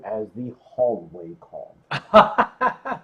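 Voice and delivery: chuckling through the words; laughing. Real, not acted. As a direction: laugh as you say it. Punchline said with a laugh.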